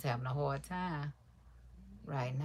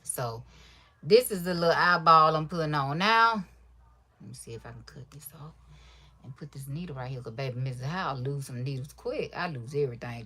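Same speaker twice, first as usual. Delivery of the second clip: usual speaking voice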